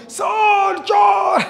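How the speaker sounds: very high, long shout; shouted alarm. Shown as a demonstration. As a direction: scream it out to sir like a thief is inside. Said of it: "तो तू जोर से चिल्लाना".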